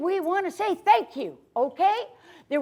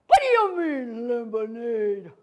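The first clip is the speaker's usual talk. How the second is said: mean cowboy; 3 semitones lower, 3 dB louder than usual. Acted. Mean cowboy challenges Larry.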